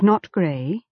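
plain reading voice

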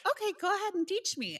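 mock indulgent. Humouring the older deponent.

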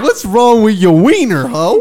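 high, loud, laughing; laughing mock yell. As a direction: yell it while cracking up. Higher, louder, laughing repeat.

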